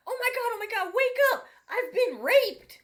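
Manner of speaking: shrill high panicked shriek, slowing at the end; imitating a frantic wife screaming in the night; hysterical wife. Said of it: "she's like".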